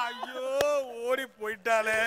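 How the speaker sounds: low, drawn-out comic wail; hammed-up lament. Jilted groom mourning his runaway bride, wailing. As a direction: moan it, then break into sobs.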